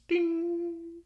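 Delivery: single high, held "ding"; PA chime imitation. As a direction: close with a high mock chime. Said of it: "It's the P A system".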